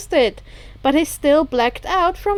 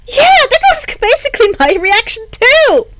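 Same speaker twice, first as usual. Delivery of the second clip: high, loud excited squeal of a reply; excited girl; 12 semitones higher, 9 dB louder than usual. Acted. Excited friend on the phone, high and loud. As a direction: voice Hagakure bubbling over, high and loud.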